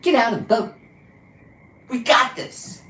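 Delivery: sing-song send-up of an upbeat voter; mocking mom. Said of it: "she told everybody on her internet".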